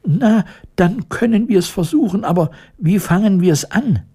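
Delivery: high-pitched mouse voice; mouse queen. A narrator's reading. Mouse queen agreeing to help the lion, high voice. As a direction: ask in a high little royal voice.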